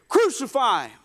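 mock shout of an angry crowd; angry mob cry. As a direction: call it out like one voice from a mob. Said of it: "somebody's mad".

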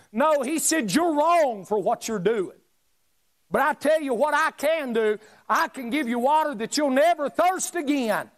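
high, ringing preacher climax; full-force preaching climax. Acted. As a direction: cry out the offer. Preaching peak.

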